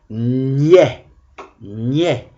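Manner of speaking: "Niais" said slowly with open è, as in balai; open è ending. Shown as a demonstration. Teacher dictating a syllable, "Niais" with open è. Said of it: "on va utiliser le est de balai".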